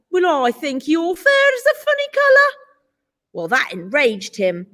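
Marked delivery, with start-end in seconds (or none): 0.1-2.5 retort. A narrator's reading. Polar bear retorts to cub.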